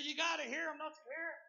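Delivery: high, loud imitation of a man hearing things; frantic hollering. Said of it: "got to hollering and screaming".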